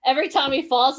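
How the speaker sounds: normal talking voice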